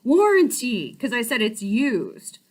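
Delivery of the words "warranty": higher, louder breezy salesman; eager salesman voice. Retold or imitated. Car salesman waving off her excuse, higher and breezy. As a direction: brush off the worry like a keen dealer. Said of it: "he was like".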